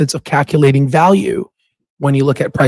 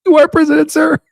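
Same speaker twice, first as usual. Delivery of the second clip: high, laughing retort; 13 semitones higher than usual. Real, not acted. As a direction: say it cracking up.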